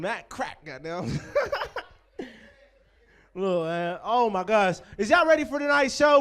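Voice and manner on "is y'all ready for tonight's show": loud emcee call to the crowd; host shouting to the audience to get them ready; hype shout. Real, not acted. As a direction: get the room going.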